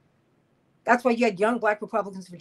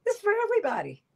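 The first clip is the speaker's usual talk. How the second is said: high, impassioned declaration; impassioned cry; 14 semitones higher, much faster than usual. Real, not acted. Host rallying listeners, high impassioned call. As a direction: lift it high for everyone. Climax of building list.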